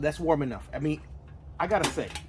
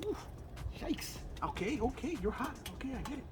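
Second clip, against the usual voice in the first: surprised; 9 semitones higher, 15 dB quieter than usual. Real, not acted. Burned by hot oven.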